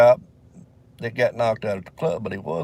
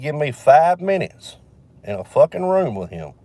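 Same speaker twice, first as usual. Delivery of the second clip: emphatic demand; 4 semitones higher, 8 dB louder than usual. Retold or imitated. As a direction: plead with the judge like you mean every word. Cousin begging the judge.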